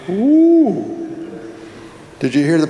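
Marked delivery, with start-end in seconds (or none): 0.1-0.8 high, loud, drawn-out ooh. Real, not acted. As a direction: let out a long rising hoot of alarm. Reacting to an ominous text.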